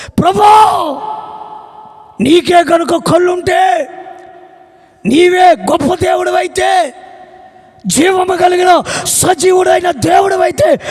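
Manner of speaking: loud, high, slowed-down crying out; shouted prayer. Acted. The wife shouts her demand at God, slow and high. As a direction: call heaven to account.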